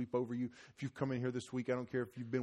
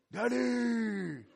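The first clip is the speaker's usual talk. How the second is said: put-on toddler calling daddy; 10 semitones higher than usual.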